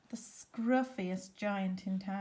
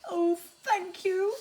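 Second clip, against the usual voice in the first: higher, louder delighted giraffe; grateful giraffe; 8 semitones higher, 7 dB louder than usual. Acted. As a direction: beam with thanks as the giraffe.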